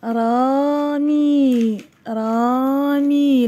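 very slow, stretched vowels; each long vowel held; drawn-out word reading. Shown as a demonstration. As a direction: sound the word out slowly for young pupils. Teacher sounds out word for pupils.

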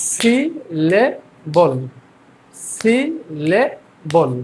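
"Syllable" very slow as si, le, bol; syllable by syllable. Shown as a demonstration. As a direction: drill "syllable" in three spaced beats. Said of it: "আমরা ভেঙে ভেঙে". ("Syllable" তিন সিলেবলে ভেঙে ধীরে)

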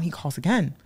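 ordinary delivery

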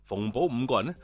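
ordinary delivery

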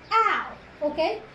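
usual speaking voice

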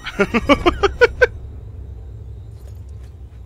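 high breathy laugh. Real, not acted. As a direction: laugh it off, rising. Risa tras perder chaleco. (risa aguda)

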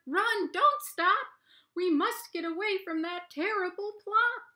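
high, loud frantic rabbit cry; panicked shout. Acted. Rabbits shouting to each other to flee, high panicked yell. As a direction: yell it like tiny creatures fleeing. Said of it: "they shout to each other".

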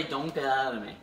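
usual speaking voice

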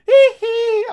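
a high falsetto squeal, very loud; loud falsetto hee hee imitation. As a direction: shriek it like the pop star. Second try at the squeal.

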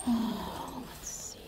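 usual speaking voice

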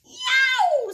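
high, loud cat shriek; startled cat yowl. Acted. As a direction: yelp like a frightened cat. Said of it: "said the cat".

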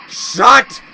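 loud, slow insult shouted; furious taunt, dragged out and loud; angry yell. Real, not acted. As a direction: bellow the insult at the monster. Yelling insults at the monster.

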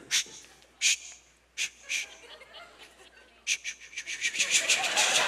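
whispered, repeated shh like a luge; sliding shush. Retold or imitated. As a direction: hiss out the sled slide. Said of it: "He's just like".

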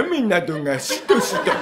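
a dragged mock of the spooky tone; mocking the spooky telling. The landlord parodying the tenant's gloomy rain line. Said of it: "それをやめろっつってんの".

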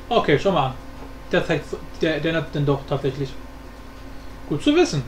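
usual speaking voice

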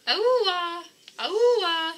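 high drawn-out whale cry; whale call. Acted. Baby whale calling out for help, high whale cry. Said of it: "sounds like an animal calling for help".

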